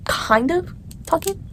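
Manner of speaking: quiet, slowed hedge with an odd voice; half-mumble the hedge, drawn out softly; soft slow aside. Real, not acted. Girl to viewers, hedging about the boy: soft slow aside. Unsure relationship status aside.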